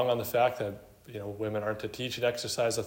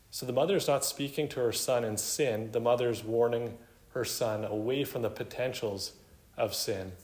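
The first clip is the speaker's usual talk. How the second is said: normal talking voice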